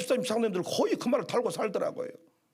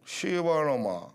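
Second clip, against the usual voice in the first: suppressed curse; 8 semitones lower, slower than usual. Retold or imitated. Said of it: "참다 참다 열받아가지고 불렀대".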